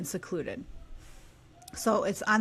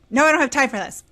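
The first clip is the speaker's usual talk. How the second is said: stern dad refusing; 2 semitones higher, 7 dB louder, faster than usual. Retold or imitated. Husband refusing.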